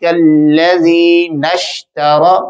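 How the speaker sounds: slow chanted recitation; Quran recitation. Shown as a demonstration. Teacher recites the full verse for the class. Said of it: "پورا پھر پڑھیں گے".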